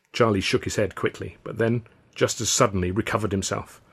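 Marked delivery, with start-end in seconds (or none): none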